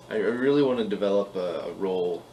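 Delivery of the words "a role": ordinary talk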